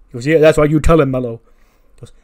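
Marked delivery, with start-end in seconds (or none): 0.3-1.4 hype man cheer. Retold or imitated. Trick hypes Mello.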